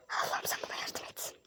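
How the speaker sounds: barely audible, breath-only whisper; whispered plea. Pretend. Traveler pleading to God for help, whispered plea. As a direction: whisper a weary little prayer for help. Exasperated private plea during packing trouble.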